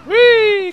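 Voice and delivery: high, loud squeal of "whee"; mock-celebration whee; celebratory whee. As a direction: cheer along with a playful squeal. Cheering along with retirement confetti.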